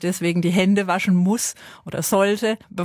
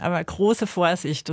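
normal talking voice

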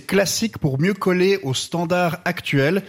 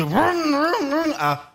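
a high revving vroom that dies down into a limp electric whine; engine noises; 8 semitones higher, much slower than usual. Retold or imitated. Comedian mocks the electric Fast and Furious engines with a fading vroom. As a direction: play the roar of a car engine and then let it fade out weakly. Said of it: "les scènes de moteurs qui vrombissent".

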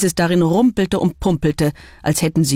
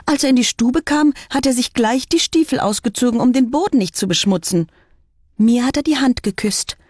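aufgeregtes Geißlein; 6 semitones higher than usual. A narrator's reading. Geißlein verteidigt den Wolf.

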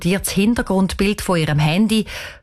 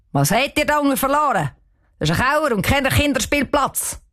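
higher, fast scolding caretaker voice; 6 semitones higher than usual. Acted. Caretaker scolding the girls in the cellar, fast and high. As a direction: catch the kids red-handed.

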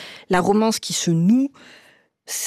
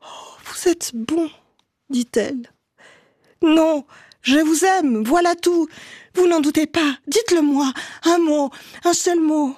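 higher, tearful pleading; with a sob; 7 semitones higher than usual. A narrator's reading. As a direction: let it break into a plea. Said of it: "avec un sanglot".

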